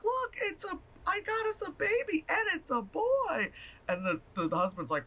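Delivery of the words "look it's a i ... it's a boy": very high, teary mock delight; high excited wife. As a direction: squeal it out like a giddy new mother. Wife voiced presenting stolen baby.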